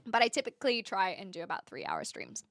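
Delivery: ordinary talk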